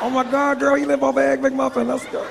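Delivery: very high mock female voice; easily impressed woman, high-pitched; gushing woman. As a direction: squeal it like a smitten woman. Smitten woman falls for it.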